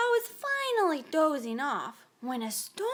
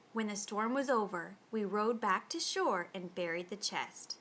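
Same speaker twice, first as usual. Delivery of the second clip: ordinary delivery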